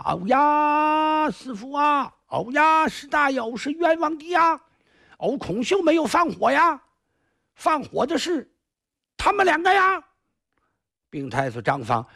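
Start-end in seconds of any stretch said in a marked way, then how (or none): 0.1-10.0 put-on high, wailing plea of a frightened boy; crying out innocence. Punished boy begging his master for mercy, high whining wail. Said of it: "这几个人大声喊冤呐".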